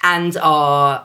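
ordinary delivery

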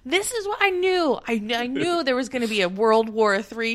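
smug dad parody. Retold or imitated.

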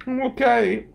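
high, fast, weepy whine; hammed-up tearful young lawyer; mock crying young man. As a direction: play the tearful guy blurting it out. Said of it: "And he's crying".

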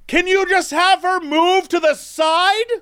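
high, slow exasperated plea; impatient traveler. Retold or imitated. A traveler at the back begging security, high and slow. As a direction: drag it out, fed up. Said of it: "someone in the back is like".

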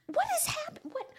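high, loud, broken-off disbelief; baffled. Retold or imitated. Viewer stunned by the performance, high baffled outburst. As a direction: squeak it out and cut yourself short.

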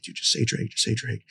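hushed, pressing him to say the name; quietly urging his friend on the phone, hushed; urgent hushed prompt. Retold or imitated. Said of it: "I'm like".

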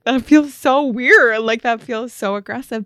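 aghast at the group text; mock horrified. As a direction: play the horrified wife. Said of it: "she was kind of horrified".

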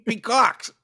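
high loud Boston "Peacocks"; Boston accent. Acted. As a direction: stretch it out Boston style. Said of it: "your Boston accent needs work there".

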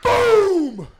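high, loud laughing boom; crash boom. Retold or imitated. Kid retelling dad's crash into the car, loud laughing boom. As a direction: shout the crash like a cartoon.

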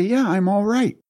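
high-pitched acted reassurance; groggy son reassuring. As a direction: act out a just-woken son answering his worried father. Waking son answers frantic father.

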